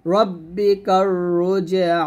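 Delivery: রব্বিকার drawn out very slowly, with a thick, full R; heavy R in recitation. Shown as a demonstration. Teacher to students, demonstrating a slow heavy R in রব্বিকার. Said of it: "এখানে রটা মোটা করবে".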